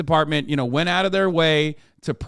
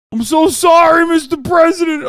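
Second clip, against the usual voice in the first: hammed-up wailing New York cop, high and shouted; New York cop accent; 13 semitones higher, 9 dB louder than usual. Host as crying cop apologizing to Trump, shouted New York accent. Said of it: "They have tears in their eyes".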